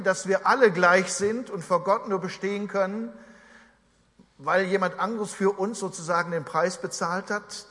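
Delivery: usual speaking voice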